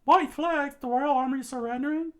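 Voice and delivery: high, loud disbelief rising to a yell; shocked outcry. Acted. Rebel shocked to see the army surrender. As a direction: cry out in disbelief.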